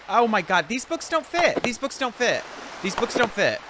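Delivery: high-pitched, frustrated complaint; exasperated outburst. Real, not acted. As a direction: get worked up, voice jumping high in exasperation. Frustrated over books not fitting.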